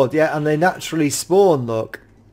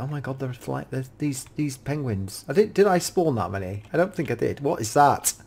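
ordinary talk

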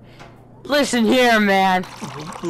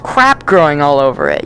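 disgusted; 2 semitones higher, 8 dB louder, slower than usual. Real, not acted. Disgust at moldy food.

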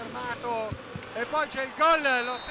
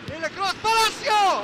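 higher, louder sudden call; excited commentary; 6 semitones higher, 6 dB louder than usual. Real, not acted. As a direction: jump on the name as the ball comes. Cross to striker, near chance.

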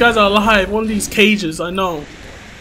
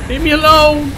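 higher, louder plea to be left alone; loud protest; 6 semitones higher, 7 dB louder than usual. Real, not acted. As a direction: call it out to push them away. Pleading with enemy, first louder take.

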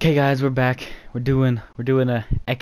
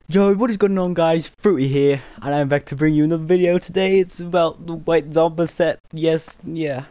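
imitating Fruity; 6 semitones higher than usual. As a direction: throw it out like an excited youtuber. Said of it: "This is gonna be so cringy".

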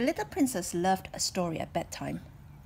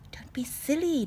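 whisper; 8 semitones higher, 4 dB louder than usual. Acted. Doctor voiced.

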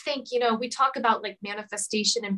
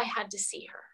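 whispered; 12 dB quieter than usual. Real, not acted. Emotional memory of reunion.

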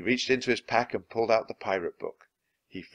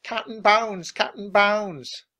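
high, slow drawn-out crew calling; pirates' cry; 12 semitones higher, 6 dB louder, slower than usual. A narrator's reading. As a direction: chant the captain's name like a rough crew. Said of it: "The pirates took up the cry".

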